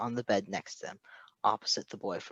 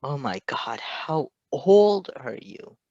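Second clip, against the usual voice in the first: whispered shock; 3 semitones higher, 5 dB louder, slower than usual. Acted. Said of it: "Patton whispered".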